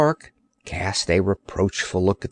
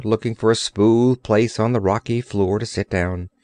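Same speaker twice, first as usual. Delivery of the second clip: plain reading voice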